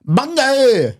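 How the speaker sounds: put-on very high, bouncy Vietnamese accent. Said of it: "You gotta be bouncy".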